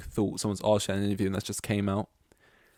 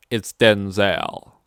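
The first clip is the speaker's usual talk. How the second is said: slow, loud, unsmiling correction; stern, drawn-out imitation of a star; dead-serious correction; 9 dB louder, much slower than usual. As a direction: correct them slowly and dead straight. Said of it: "not saying it with a smile".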